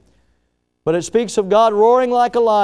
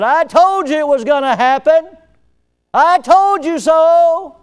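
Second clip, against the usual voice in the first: higher, louder, told-you-so scolding, ending slow; mock scolding; 11 semitones higher, 9 dB louder than usual. A father scolding his returning son, mock told-you-so. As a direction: scold him like a smug father rubbing it in. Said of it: "chew him out".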